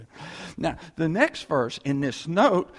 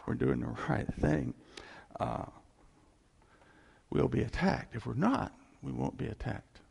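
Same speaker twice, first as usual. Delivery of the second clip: normal talking voice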